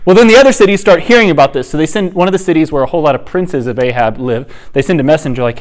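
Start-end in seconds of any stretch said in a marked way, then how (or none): none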